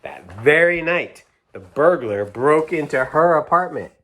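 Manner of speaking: picture-book reading; reading to children. A narrator's reading. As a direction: build suspense for a child. Reads picture book aloud.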